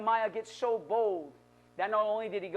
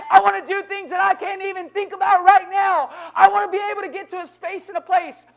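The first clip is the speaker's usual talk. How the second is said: higher and louder, building to a shout; preaching at full force; 7 semitones higher, 11 dB louder than usual. Acted. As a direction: drive it home to the church, voice climbing. Preaching climax to congregation.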